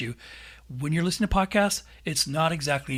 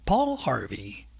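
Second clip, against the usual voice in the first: put-on old radio host's drawn-out sign-off; Paul Harvey; slower than usual. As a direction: impersonate a deliberate radio broadcaster. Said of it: "Paul Harvey, he used to do a radio show".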